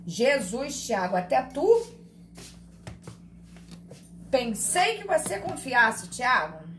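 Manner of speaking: mock "et tu, Brute" scolding; mock betrayal. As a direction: play the betrayed teacher for laughs. Teacher mock-betrayed by silent student.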